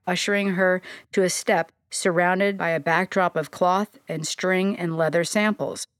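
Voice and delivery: plain reading voice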